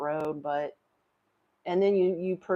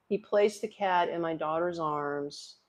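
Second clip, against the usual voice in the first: usual speaking voice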